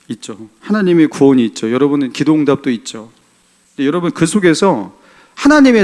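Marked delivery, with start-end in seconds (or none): 0.1-0.5 soft echo. Acted.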